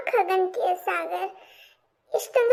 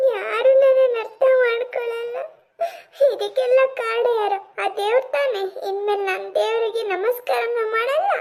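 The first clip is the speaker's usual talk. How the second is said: tearful, sarcastic grievance against God; bitter crying; 2 semitones higher than usual. Acted. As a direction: protest through sobs, wounded and resentful. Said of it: "ಯಾಕ್ ಹೀಗೆಲ್ಲ ಅಳ್ತಿದ್ಯಾ?".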